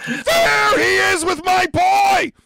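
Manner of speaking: gleeful high shout at a great roll; excited shout. Real, not acted. Player cheers another's dice roll.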